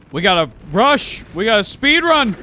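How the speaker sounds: urgent rallying shout. Real, not acted. Urging team in timed rush.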